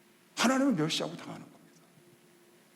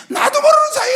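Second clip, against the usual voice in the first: much higher, much louder repeated phrase; preaching shout; 12 dB louder than usual. Real, not acted. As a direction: cry it out at full force. (나도 모르는 사이에를 크게 외치는 설교)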